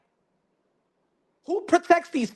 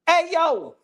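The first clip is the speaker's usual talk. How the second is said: ordinary talk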